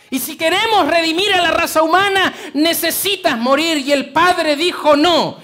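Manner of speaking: loud, high, ringing sermon voice; fervent pulpit cry; full-force preaching. Acted. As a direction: preach it out to the whole church.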